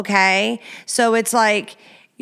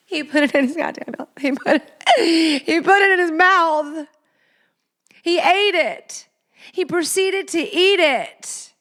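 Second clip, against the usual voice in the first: higher, pitch climbing in disgusted shock; appalled disbelief; 7 semitones higher than usual. Real, not acted. Host to listeners, shocked the man ate it. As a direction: say it like you can't believe it.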